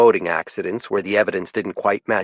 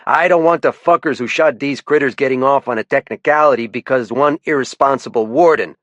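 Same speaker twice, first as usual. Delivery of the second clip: put-on sharp louder challenge in a light French-Canadian accent; lieutenant calling out a warden, faint French-Canadian lilt; a touch of French-Canadian accent, higher; 5 semitones higher, 5 dB louder than usual. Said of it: "His accent tended to make his T H's sound like D's".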